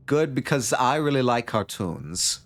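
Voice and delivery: put-on second try at a Tommy Wiseau accent. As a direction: Nomi redone in the Tommy Wiseau voice, slow. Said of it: "I do need to dial in the Tommy Wiseau accent".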